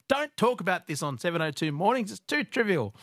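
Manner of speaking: mock complaint. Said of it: "a lot of people said".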